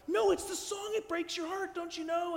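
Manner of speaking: high, pained mock protest; hurt pleading. Preacher as herself, begging her partner to feel the song. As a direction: plead that the song breaks your heart.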